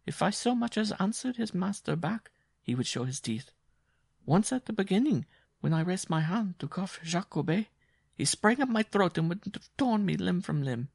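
a worn-out woman telling her hardship; Jean's voice. Acted.

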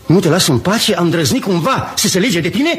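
heated, high-pitched questioning; indignant, pitch jumping up; impassioned. Acted. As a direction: demand it with too much passion. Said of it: "mai puțină pasiune".

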